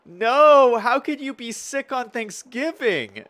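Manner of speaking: loud, high cry of dismay; high-pitched, drawn-out "No" of dismay. Real, not acted. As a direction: wail it in sympathetic disbelief. Reacting to viewer sick on Thanksgiving.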